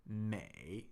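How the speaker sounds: 美 soft and low, dipping third tone. Shown as a demonstration. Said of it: "third tone".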